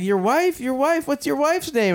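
lightly voiced high questioning bystander; bystander asking. Stranger questioning the bleeding man, mock high puzzlement. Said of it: "somebody's like".

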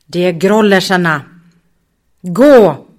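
loud, urgent order to flee; urgent shout. Acted. Muffin skriker åt de andra att fly, brådskande. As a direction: bark the order to run. Muffin urges flight from grollers.

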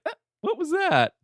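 high startled yelp. Retold or imitated. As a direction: jump at a sudden noise. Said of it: "they're like".

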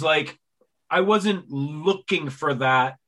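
ordinary talk

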